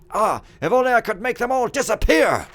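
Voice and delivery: loud, high cry of frustration; hammed-up exasperation, wishing them all gone; exasperated outburst. Steward wishing the servants would disappear, hammed-up exasperation.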